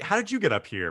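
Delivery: surprised. Real, not acted. Surprised question.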